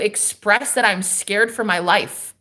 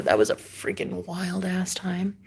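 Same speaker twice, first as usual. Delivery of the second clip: whispered aside; 7 dB quieter than usual. Real, not acted. Whispered aside after story.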